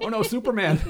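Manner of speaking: high, laughing yelp of a beaten hero; mock cry for help. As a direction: squeal like a hero losing a fight. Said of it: "so that was like".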